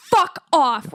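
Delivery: mean "fuck off" the American way; an American telling someone off. Retold or imitated. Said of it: "Americans are like".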